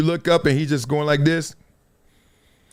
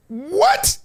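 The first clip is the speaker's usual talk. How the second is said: high rising what; stunned squeak; shocked. Real, not acted. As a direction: yelp it in disbelief. Shocked reaction.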